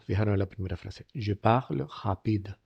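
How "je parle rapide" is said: slow "parle rapide", R lightly aspirated in the throat; R like a soft Andalusian J in "parle rapide"; very soft French R. Shown as a demonstration. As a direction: model PARLE RAPIDE with a gentle h-like R. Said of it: "Muy suave".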